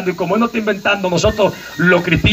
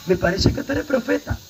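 samaritan woman; 6 semitones higher, 6 dB quieter than usual. Retold or imitated. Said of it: "Así le dijo".

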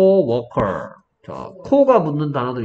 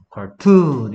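카툰 with a long, stressed 툰; second-syllable stress; 6 dB louder, much slower than usual. Shown as a demonstration. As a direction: hit and stretch the second syllable 툰 in 카툰. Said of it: "이음절 강세 단어".

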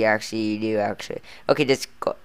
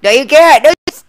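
higher, louder sharp no; loud protest; 10 semitones higher, 7 dB louder than usual. Acted. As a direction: cut him off, loud. Arguing, cutting friend off.

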